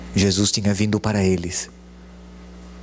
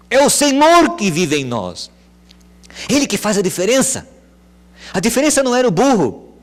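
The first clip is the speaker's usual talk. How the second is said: high, loud preacher's build; preaching at full force; 9 semitones higher, 5 dB louder than usual. Acted. Pastor lifting the congregation, preaching loud. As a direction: preach it out, raising the voice.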